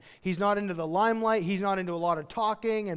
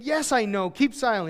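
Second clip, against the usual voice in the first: raised, louder brush-off; irritated retort; 5 semitones higher, 6 dB louder than usual. Acted. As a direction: cut them off, a little exasperated. Acts out Elisha's irritated reply.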